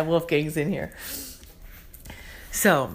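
normal talking voice

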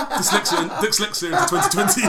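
laughing through a slip. Real, not acted. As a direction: giggle the words out.

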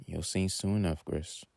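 soft, hushed aside; whispering to herself. A narrator's reading. Mother privately sure her son will learn, soft near-whisper. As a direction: drop the voice, trailing to very quiet. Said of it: "She then whispers to herself".

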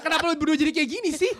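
high-pitched, laughing protest. Real, not acted. Guest asking the hosts what became of them, laughing. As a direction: protest while cracking up.

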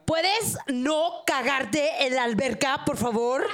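slow, drawn-out Mexican accent. Shown as a demonstration. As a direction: ask politely in a Mexican accent. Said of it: "en mexicano".

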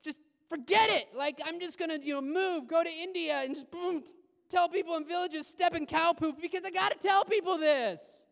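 can't wait to go and tell; eager zeal. Retold or imitated. Imagined believer so thrilled he'd move to India, excited. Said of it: "makes you go".

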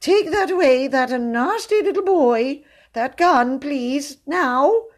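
high, frightened plea; plaintive pleading. A narrator's reading. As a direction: beg the captor plaintively. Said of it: "pleaded his captive plaintively".

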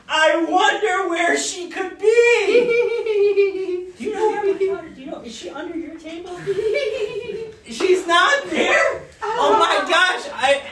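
hammed-up searching dad. Dad pretending to search for his hiding daughter, hammed-up. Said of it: "I'm playing your role".

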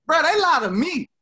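high, loud and fast; excited. Real, not acted. Excited laughing rant.

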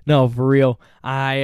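normal talking voice

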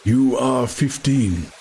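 a hint of a slow old man; lightly voiced elder, unhurried; old Mitsima. A narrator's reading. Old teacher addressing the boy, lightly voiced elder. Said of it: "said old Mitsima".

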